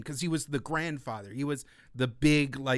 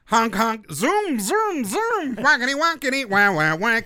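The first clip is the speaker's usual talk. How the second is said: high, loud honking clown voice; silly clown; 6 semitones higher, 7 dB louder than usual. Pretend. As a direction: honk and zoom like a zany clown. Zany podcast intro noises.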